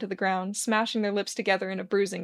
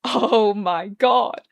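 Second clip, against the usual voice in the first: moaning with joy; 7 dB louder, much slower than usual. A narrator's reading. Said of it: "practically moans with joy as he chews".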